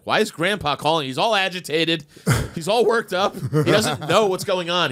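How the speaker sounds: high, fretful mom voice, slowing at the end; mom's voice. Retold or imitated.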